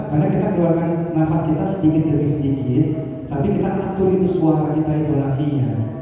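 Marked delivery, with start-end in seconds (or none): none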